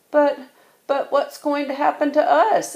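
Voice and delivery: high, anxious little cub; worried cub voice. A narrator's reading. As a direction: stammer it out like a worried young cub. Worried cub asking parents.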